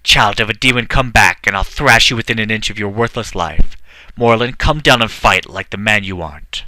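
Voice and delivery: loud raging king yelling after them; naked king screaming from the battlements; furious scream. Acted. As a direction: bellow curses at the fleeing dragon. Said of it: "screaming and waving his fists".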